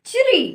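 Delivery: चिरी sung out high, ch blended with ri; model chri. Shown as a demonstration. Teacher presents the blended sound चिरी to pupils. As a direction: announce चिरी with a high lift. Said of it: "तो ये हो जाएगा हमारा".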